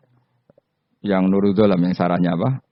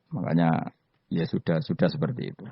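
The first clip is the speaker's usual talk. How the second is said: ordinary delivery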